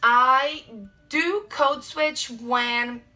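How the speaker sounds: ordinary talk, nothing marked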